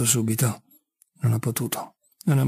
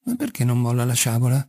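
plain reading voice

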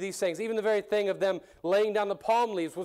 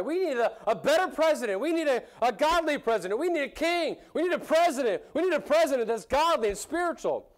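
higher, eager calls for a better president; crowd crying out today; 8 semitones higher than usual. Retold or imitated. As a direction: play today's crowd pleading for a leader. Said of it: "It's still the cry".

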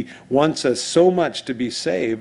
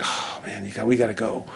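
wistful sigh; 2 semitones lower, 6 dB quieter than usual. Retold or imitated. Friend reluctantly ending a conversation.

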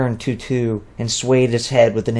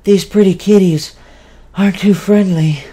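through heavy breaths; 8 semitones higher, 5 dB louder than usual. Acted. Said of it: "spoke through heavy breaths".

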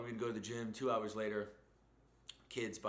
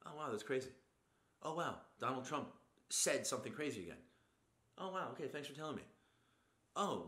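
sarcastic unimpressed; 5 semitones higher than usual. Retold or imitated. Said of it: "and then you could be like".

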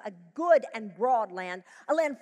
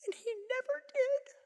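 imagined lament climbing higher to a pained peak; regretful believer; 6 semitones higher, 7 dB quieter than usual. Pretend. Preacher voicing a regretful believer at life's end, rising lament. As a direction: voice the disappointed soul at life's end. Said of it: "we could get to the end of our lives and go".

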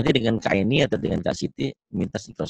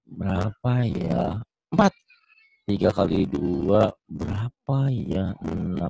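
unsure hesitant; 5 semitones lower, 4 dB quieter, slower than usual. Shown as a demonstration. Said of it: "Nah, nggak boleh begitu".

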